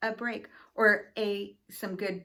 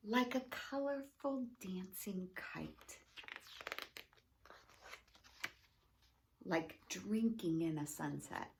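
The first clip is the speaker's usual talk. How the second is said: story reading; 2 semitones lower than usual. Acted. Principal reading a picture book to school families, gentle storytelling. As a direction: read it like a bedtime picture book.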